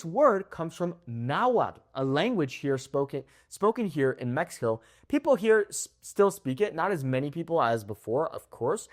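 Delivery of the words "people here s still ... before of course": ordinary talk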